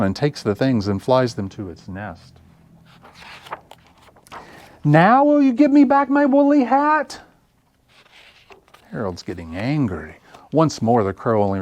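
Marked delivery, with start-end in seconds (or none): 4.8-7.2 much higher and louder, straining; high loud plea. Acted. The bear demands his hat back from the crow, high and loud. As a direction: push the question up high and loud.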